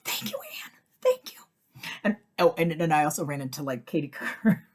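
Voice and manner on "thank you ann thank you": gushing thanks. Retold or imitated.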